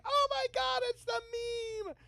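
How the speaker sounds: very high-pitched mock squeal; imitated excited squeal. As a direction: squeal it like a thrilled fan spotting a meme. Imitates daughter spotting a meme.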